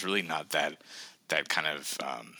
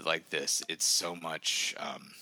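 normal talking voice

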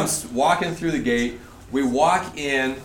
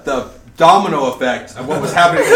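normal talking voice

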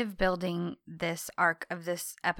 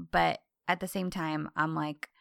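ordinary delivery

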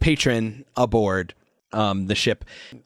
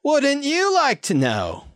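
very high, drawn-out mimicked retort; Melinda; slower than usual. Talking bird answers Marco's question, high borrowed voice with attitude. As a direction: stretch it out high, as a teasing comeback. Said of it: "in a voice that you recognize as Melinda's".

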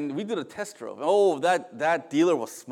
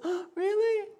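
very high mock-wife squeal of surprise; playfully hammed-up astonishment; imitated delighted surprise. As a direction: react as a thrilled wife, way up high. Wife's delighted reply, acted.

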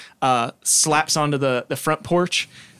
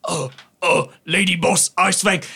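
higher, nervous orc captain; orc pirate captain; 3 semitones higher than usual. Acted. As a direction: stammer to the boss. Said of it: "the throne figure interrupts".

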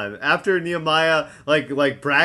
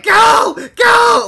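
high shouted cheer; 10 dB louder than usual. Retold or imitated. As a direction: yell it out, urgent. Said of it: "yelling at Big Easy".

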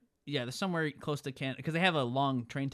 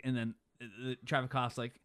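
ordinary talk

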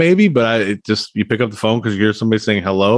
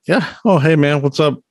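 pitched-up breezy greeting; mock casual; 6 semitones higher than usual. Contrasting Herman with a groggy waker, mock casual. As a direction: imitate a carefree hello, starting high.

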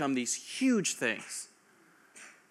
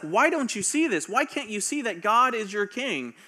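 higher, louder exasperated scolding; angry Samuel; 7 semitones higher, 4 dB louder than usual. Retold or imitated. Samuel scolding the people, retold angry outburst. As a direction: rebuke them, fed up and hurt. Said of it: "He's mad".

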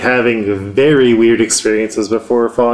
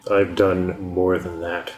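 low, menacing tiger man; 4 semitones lower, 5 dB quieter than usual. Acted. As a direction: speak like a looming beast.

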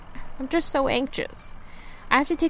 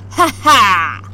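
loud gloating ha ha; triumphant laugh; 3 semitones lower, 8 dB louder than usual. Acted. Driver dodging a rude car, triumphant laugh. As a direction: crow over the bad driver.

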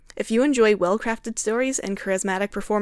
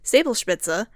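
normal talking voice

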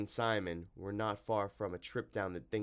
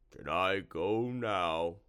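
slow, louder creature whine; drawn-out monster plea; slimy monster, pleading; 5 dB louder, much slower than usual. A narrator's reading. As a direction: drag out the request as a cowed monster.

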